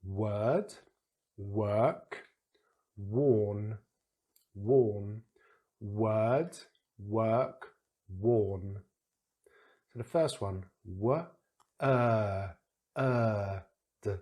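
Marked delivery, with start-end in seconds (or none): 0.0-8.8 word and work with er, worn with or; slow careful models. Shown as a demonstration. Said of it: "have you worked it out". 10.9-14.1 word split into w, er, d with a long er; sounding out word. Shown as a demonstration. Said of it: "nice long vowel sound".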